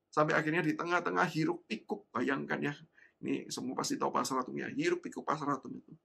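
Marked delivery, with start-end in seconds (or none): none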